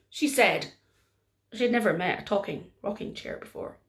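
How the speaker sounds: ordinary delivery, nothing marked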